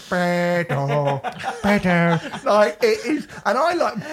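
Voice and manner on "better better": mock kid voice. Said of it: "little kid ... going".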